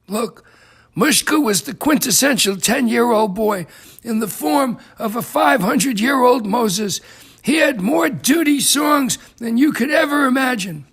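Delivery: usual speaking voice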